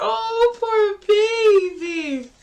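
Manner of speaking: high, slow cooing sympathy; cooing pity. Retold or imitated. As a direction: coo it like to a baby. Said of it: "I was like".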